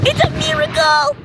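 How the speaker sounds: high ecstatic shriek; shrieking jackpot winner. Pretend. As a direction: scream it like you won the lottery. Shrieking over fireworks.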